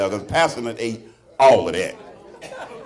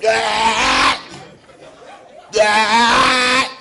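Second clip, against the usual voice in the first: mock throwing up into the toilet; fake retching; 8 semitones higher than usual. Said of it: "acting like I'm sick, throwing up".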